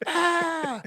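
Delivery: very high mock scream; imitated children's scream. Soldier hears school children after the blast, mock scream. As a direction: imitate a far-off crowd of screaming kids. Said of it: "we hear all these school children".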